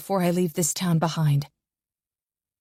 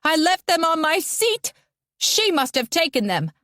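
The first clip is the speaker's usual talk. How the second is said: high accusing shout; 10 semitones higher than usual. A narrator's reading. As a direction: accuse her in a raised high voice. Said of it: "starts screaming".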